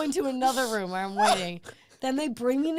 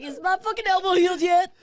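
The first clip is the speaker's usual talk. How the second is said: raised, louder retold complaint; mock impatience; 7 semitones higher, 5 dB louder, faster than usual. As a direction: act out an exasperated demand, higher and louder. Retold sarcastic outburst to staff.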